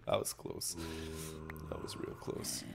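whispered relief after near death. Real, not acted. Streamer whispering after almost dying in the game, whisper. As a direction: breathe it out unvoiced.

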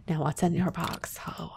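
mock secretive whisper; playful hushed taunt; teasing whisper. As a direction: lean in and whisper.

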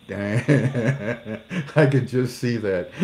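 slow words through laughter; amused, laughing through the words. Real, not acted. Laughing while speaking.

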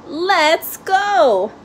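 calling out. A narrator's reading. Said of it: "called his father".

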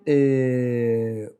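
ordinary delivery

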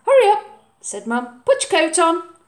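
loud, high hurrying call; brisk mum. Acted. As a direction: chivvy the child out the door. Mum's voice.

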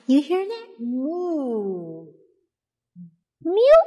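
high, loud squeaky meow after a gasp; playful meow for baby. Acted. Mother making animal sounds for her baby, high and playful. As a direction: play the kitten for the baby.

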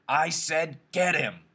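slow, loud, high-pitched yell. A narrator's reading. George orders Lenny to fight back, yelling. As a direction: yell each word as an order. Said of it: "George yelled again".

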